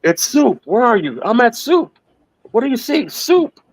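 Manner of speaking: climbing squeaky cartoon voices; very high cartoon voices. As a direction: push the puppet voices way up. Replaying the soup joke.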